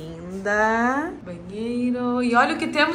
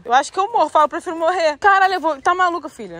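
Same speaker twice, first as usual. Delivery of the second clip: panicky fear; 6 semitones higher, 5 dB louder, faster than usual. Real, not acted. Terrified on the narrow path.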